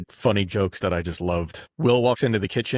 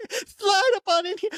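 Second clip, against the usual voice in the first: much higher, hammed-up Leno voice; mock Jay Leno. Leno repeating Will's line in a high mock voice. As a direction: squeal it like a baffled host. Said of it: "Jay Leno was like".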